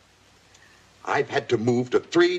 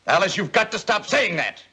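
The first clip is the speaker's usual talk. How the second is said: furious yell; 10 semitones higher than usual. Acted. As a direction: bark it out, losing patience. Father yells at daughter.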